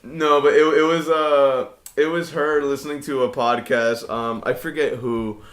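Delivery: usual speaking voice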